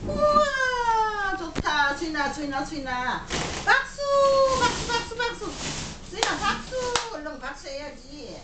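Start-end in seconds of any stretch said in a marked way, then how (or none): 0.1-1.4 squeaky praising a dog. Real, not acted. Dad cheering baby's clapping. 3.6-6.8 high squeaky cheering; squeaky praising a dog. Real, not acted. Dad urging baby to clap.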